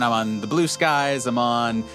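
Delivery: ordinary delivery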